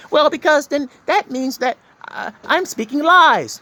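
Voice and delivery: high, put-on voice of a doubting listener; hammed-up skeptic pushing back; whiny objector.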